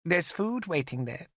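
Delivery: put-on high, giggly young woman's voice; light, teasing girl; girlish Olwyn. Olwyn leaves him caged.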